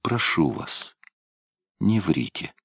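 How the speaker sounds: заметно тише, почти шёпотом; умолять вполголоса; тихая мольба. A narrator's reading. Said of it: "прошептал он". (тихая мольба вполголоса)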